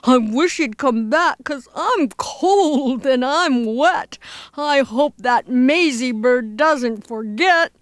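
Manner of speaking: drawn-out, complaining elephant voice; grumbling. Acted. Elephant cold and wet in storm, grumbling. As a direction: moan about the miserable weather. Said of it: "The poor elephant grumbled".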